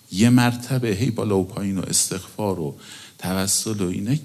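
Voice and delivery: usual speaking voice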